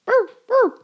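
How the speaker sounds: high, loud shivering brr; cold shivering brr. Acted. Reader voices a shivering character, high and loud. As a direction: shiver it out in a squeaky voice.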